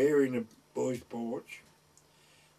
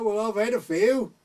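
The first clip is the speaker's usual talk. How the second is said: tipsy admission; 8 semitones higher, 7 dB louder than usual. Retold or imitated. Drunk lad admits.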